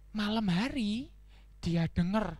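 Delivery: usual speaking voice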